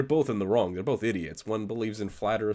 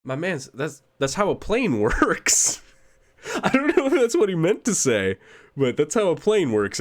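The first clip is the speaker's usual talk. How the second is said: incredulous; 8 semitones higher, 6 dB louder than usual. Real, not acted. Mocking the flight path claim.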